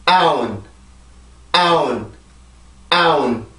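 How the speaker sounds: 아운 with stress on 아, n closing; rhythm drill with n. Shown as a demonstration. Teacher adding the n sound in a drill. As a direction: chant 아운 as one stressed beat ending in n. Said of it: "다시 한번".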